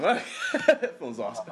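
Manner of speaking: slow, laughing through it. Real, not acted. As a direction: savour it with a laugh.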